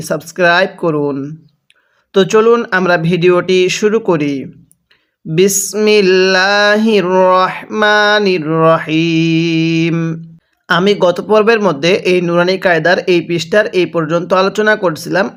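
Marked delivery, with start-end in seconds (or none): 5.3-10.0 very slow, drawn-out recitation of the Bismillah; Quranic recitation. Real, not acted. Teacher opening the video lesson with Bismillah, slow melodic recitation. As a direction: recite with long, sustained, level vowels.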